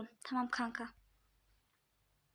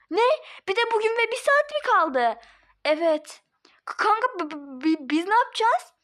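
panicked stammer; 7 semitones higher, 4 dB louder than usual. Acted. Panicked friend.

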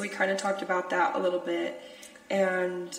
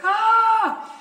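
sharp high "Ah!" burst; a quick startled cry, loud and high; short panicked yelp; 10 dB louder than usual. Shown as a demonstration. Recounting freaking out at camp with a yelp. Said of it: "Not, like, super bad screaming, it's more like".

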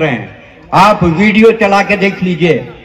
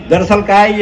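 usual speaking voice

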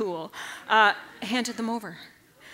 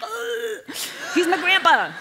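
disgusted retort; 8 semitones higher, 6 dB louder, slower than usual. Retold or imitated. Disgusted retort, pitch jumps.

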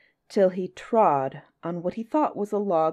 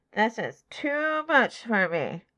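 slow, higher, pinched-nose elephant; through the nose; 4 semitones higher, slower than usual. A narrator's reading. Elephant's child losing the tug of war, through his nose. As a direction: talk with the nose blocked, drawn out. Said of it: "he said through his nose".

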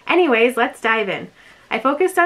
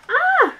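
short, high-pitched happy cry; delighted squeal. Real, not acted. Vlogger seeing the next item, delighted squeal. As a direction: let out a high little squeal.

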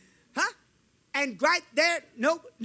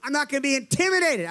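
ordinary talk

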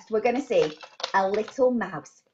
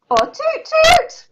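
high and slower toot toot; 7 dB louder, much slower than usual. Shown as a demonstration. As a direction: try a second, drawn-out boat horn. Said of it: "What does a boat do?".

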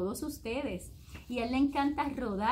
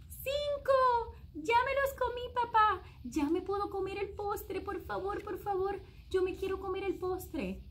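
high eager please please; excited child begging; 8 semitones higher than usual. A narrator's reading. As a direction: beg sweetly like a kid. Child finishes, begs dessert.

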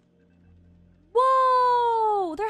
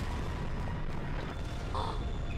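long breathy gasp; 11 dB quieter than usual. Real, not acted. Shocked by chainsaw toilet.

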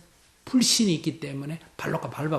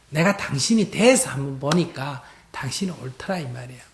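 usual speaking voice

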